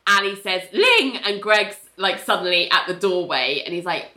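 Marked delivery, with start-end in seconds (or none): 0.7-1.2 sharp shout. Retold or imitated. Ali snaps at Ling.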